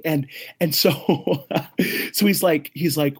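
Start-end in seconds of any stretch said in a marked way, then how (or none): none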